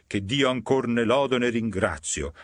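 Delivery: plain reading voice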